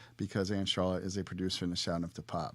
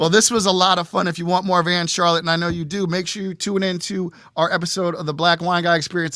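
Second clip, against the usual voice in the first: loud, raised-pitch host outro; show sign-off; 7 semitones higher, 10 dB louder than usual. Real, not acted. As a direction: punch out the plug, ending on a high, shouted farewell. Signs off the show.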